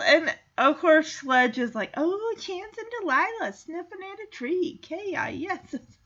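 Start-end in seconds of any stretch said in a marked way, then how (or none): 1.9-6.0 high, soft playground chant; teasing singsong. Retold or imitated. Sledge teases Chance and Delilah, mocking singsong.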